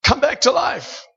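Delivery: high, slow commanding prayer over a body; mock resurrection prayer. Preacher acting out a morgue prayer, mock commanding. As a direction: command the dead to rise, half in jest.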